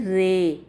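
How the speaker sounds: رے with a long open e; imala "ray". Shown as a demonstration. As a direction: draw out رے as "ray", between aa and ee. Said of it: "آپ نے اس کو پڑھنا ہے".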